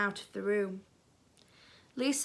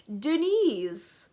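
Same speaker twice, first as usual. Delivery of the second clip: put-on French accent; 3 semitones higher, 4 dB louder than usual. A narrator's reading. Dennis tries out his new name in a French accent. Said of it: "in a French accent, it sounds French".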